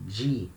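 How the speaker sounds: "G" said as "djee"; a single "G" syllable with an English j sound; soft Rio sound. Shown as a demonstration. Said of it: "In Rio".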